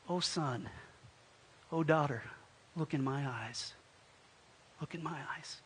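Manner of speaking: soft, low, tender appeal; loving stranger. Acted. Kind stranger consoling a tearful tenant, soft and loving. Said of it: "a look that can only be described".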